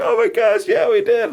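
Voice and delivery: high-pitched, excited mock squeal; thrilled exclamation. Retelling a couple's reply just after proposing, thrilled. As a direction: imitate an overjoyed couple, high and loud.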